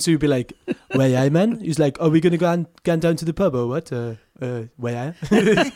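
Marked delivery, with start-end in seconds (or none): 0.9-1.6 mock French-accented Geordie. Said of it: "but in a French accent". 2.0-5.7 lifted, playful French-Geordie impression; French Geordie accent. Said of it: "like, a French Geordie accent".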